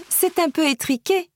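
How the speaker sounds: laughing. A narrator's reading. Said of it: "dit-elle en riant".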